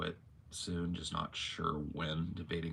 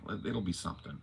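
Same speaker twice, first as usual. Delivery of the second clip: ordinary talk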